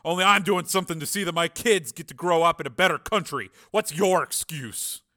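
army sergeant's loud scorn; contemptuous sergeant. Acted. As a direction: chew out the wannabe. Narrator voices sergeant rebuking Steele.

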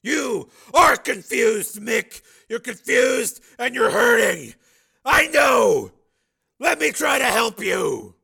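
very high, loud appeal; pleading shout. A narrator's reading. Steel appealing to his old partner, shouting. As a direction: plead across the distance.

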